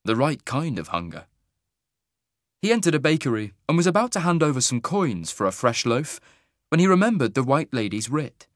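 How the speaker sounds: ordinary delivery, nothing marked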